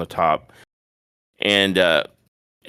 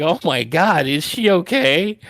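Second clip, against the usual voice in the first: high-pitched mock concern; retold alarm; 9 semitones higher than usual. Viewers reacting to the wrestler's screams, mock alarm. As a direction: react like a worried onlooker, hammed-up. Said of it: "And it's like".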